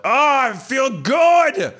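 high, loud, drawn-out gym elation; hammed-up roar of feeling great; pumped-up exclamation.

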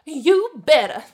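put-on loud, high snarl in an affected accent; loud, snooty warning. A narrator's reading. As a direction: snarl it as a haughty threat. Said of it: "Melinda snarled".

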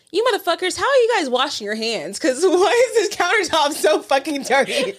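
high-pitched comic scolding, breaking into laughter; hammed-up indignant telling-off; mock outrage. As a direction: rant at messy guests, half laughing. Acted scolding of dirty guests.

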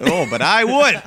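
loud, high-pitched comeback; playful defiance. Real, not acted. Teased co-host defending his impression, loud defiance. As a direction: throw it back loudly and proudly.

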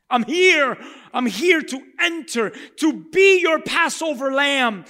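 loud high triumphant proclamation; preacher voicing Jesus, shouted and high; Jesus proclaiming. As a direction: announce his arrival with full voice.

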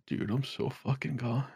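hushed, spaced-out mumble; stoned chancellor. Retold or imitated. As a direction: play it very stoned and soft. Chancellor high on gummies answers, acted.

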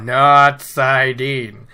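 not saidin, slow and pointed; contrast stress. Shown as a demonstration. Correcting the student, slow and pointed. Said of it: "That's how you do saidar".

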